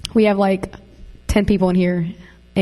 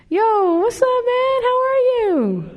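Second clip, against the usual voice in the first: extremely high, drawn-out sing-song hello; squealed greeting; slower than usual. Real, not acted. Host hails someone arriving at live podcast, high squealed greeting. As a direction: squeal it way up high, stretching the last words.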